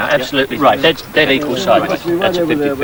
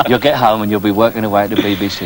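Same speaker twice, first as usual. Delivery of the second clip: quick teasing patter; 6 semitones lower than usual. Real, not acted. Teasing quote of colleague.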